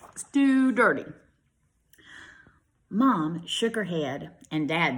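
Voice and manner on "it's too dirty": higher, louder disgusted parent. Acted. Parents rejecting the nasty bear, disgusted. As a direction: spit out the disgust.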